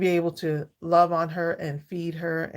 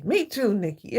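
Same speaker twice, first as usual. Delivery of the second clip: normal talking voice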